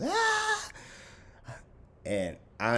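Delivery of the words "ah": mock falling scream, pitched way up; high mock scream. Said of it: "and I'm just".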